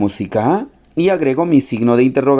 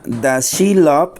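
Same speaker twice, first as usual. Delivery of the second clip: slow model phrase; much slower than usual. Shown as a demonstration. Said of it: "En la interrogativa".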